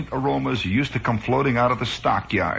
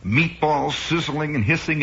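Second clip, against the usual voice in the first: normal talking voice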